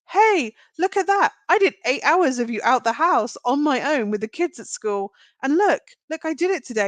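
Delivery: high, proud announcement; excited boasting. Retold or imitated. Wife proudly greeting husband coming home, excited boasting. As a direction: show off a small win, pitched high.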